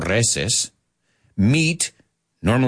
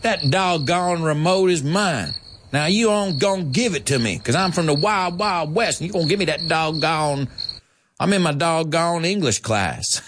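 mock Southern; 6 semitones higher than usual. A teacher mimics a Southerner demanding the remote, hammed-up. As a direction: play a wild west Southerner. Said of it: "But in the South, say".

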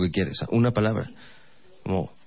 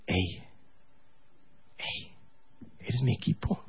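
much softer, intimate pep talk; soft coaxing; 12 dB quieter, slower than usual. Retold or imitated. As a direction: murmur it to calm your partner. Coaxing girlfriend softly.

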